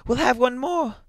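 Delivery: high, loud, urgent exultation; elated old woman urging her husband on; triumphant. A narrator's reading. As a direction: command it with mounting, high-pitched triumph. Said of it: "she cried triumphantly".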